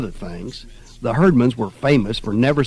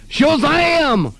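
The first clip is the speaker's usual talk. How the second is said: put-on much higher, louder kid shout; yelled; 7 dB louder than usual. A narrator's reading. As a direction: yell it like a comic hero. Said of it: "Gladys yelled".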